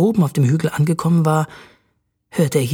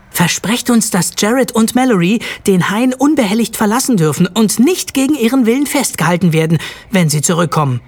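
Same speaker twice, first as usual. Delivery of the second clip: higher, insistent demand; 6 semitones higher, 4 dB louder than usual. A narrator's reading. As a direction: press for a promise, moderately forceful. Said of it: "forderte Jared". (nachdrücklich fordernd, mit höherer Stimme)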